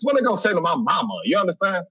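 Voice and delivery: usual speaking voice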